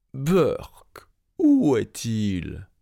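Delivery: harsh whispered growl of disgust; menacing giant. A narrator's reading. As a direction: hiss it like a hunting ogre. Giant smells a human.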